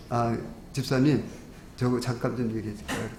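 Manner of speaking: low, softened retold quiet question; discreet asking. Preacher quietly approaching the deaconess aside, discreet asking. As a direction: retell it softly, like a discreet approach. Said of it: "이제 살짝 물었어요".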